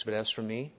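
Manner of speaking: soft, calm and unhurried, spoken quietly; calmly. Shown as a demonstration. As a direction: let "But as for me" rest in quiet calm, no push.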